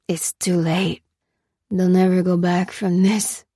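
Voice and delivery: effortful, anesthetized-sounding delivery; slurred speech. A narrator's reading. Weakened prisoner gives up hope to Lucy, slurred. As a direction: labour over the words through pain. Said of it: "His words were slurred and sounded difficult".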